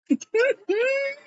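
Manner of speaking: very high-pitched giggle; squeaky, amused laugh. Real, not acted. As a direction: let out a high little giggle. Amused aside during recap.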